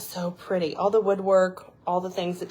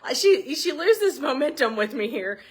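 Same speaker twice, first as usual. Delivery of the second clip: laughing; 11 semitones higher, 3 dB louder than usual. Real, not acted. Laughing after dog-voice bit.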